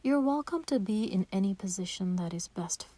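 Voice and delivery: ordinary delivery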